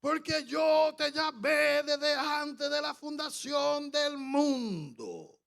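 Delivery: high, slow, loud prophetic declaration; solemn drawn-out prophecy. Retold or imitated. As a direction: stretch every word like a prophet speaking for God. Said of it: "Pero hablando con este tono".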